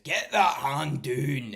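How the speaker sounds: loud, high, drawn-out Scottish holler; Davey. Retold or imitated. Showing a Perth streamer's accent, loud slow high holler. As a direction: shout it slowly in a thick Scottish accent. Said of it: "but the accent's just".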